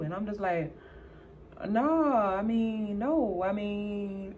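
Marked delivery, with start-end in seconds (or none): 1.7-4.3 slow, drawn-out stammer; flustered, hesitant false starts; stuttering denial. Retold or imitated. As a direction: stall for time, stretching each word. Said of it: "I'm stuttering".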